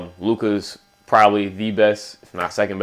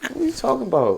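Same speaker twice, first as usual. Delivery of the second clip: much higher, baffled mock reply; incredulous; 3 dB quieter than usual. Cohost acting his own baffled reaction, high incredulous. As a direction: sound baffled, pitched way up. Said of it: "I'm like".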